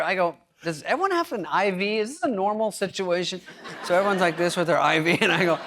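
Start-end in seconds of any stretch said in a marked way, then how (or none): 0.6-3.4 higher-pitched puzzled asking; mock bewilderment at an odd scene; bewildered question. Comic asking about IVs in the hotel elevator, bewildered.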